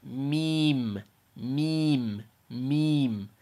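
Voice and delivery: "Meme" light, with a long ee, lips closing on m; Arabic meem. Shown as a demonstration. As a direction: draw out "meme" lightly and let it fall. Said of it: "You just let your lips touch".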